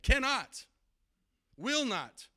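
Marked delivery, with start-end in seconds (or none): 0.0-0.6 Cannot thrown out high and firm; emphatic cannot. Shown as a demonstration. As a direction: land Cannot like a verdict. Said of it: "say it emphatically".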